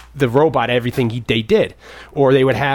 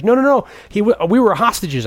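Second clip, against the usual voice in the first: high, louder, frantic protest; mock pleading; 10 semitones higher, 3 dB louder than usual. Gutenberg's character pleading with Pendleton, high mock panic. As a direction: beg to keep the job, pitched way up. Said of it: "He's like".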